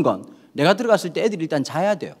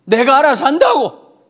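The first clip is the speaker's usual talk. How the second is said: higher, louder, slow Gyeongsang snap; gruff Gyeongsang father; 7 semitones higher, 6 dB louder, slower than usual. Acted. King David brushing off Absalom, gruff Gyeongsang accent. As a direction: bark it back like an irritated Gyeongsang dad. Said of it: "전형적으로 경상도 기질인 거죠".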